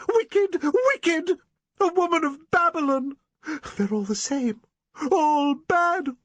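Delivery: a terrified woman losing control; shrieking in hysterical fear. Acted. Eleanor hysterical over the visitor.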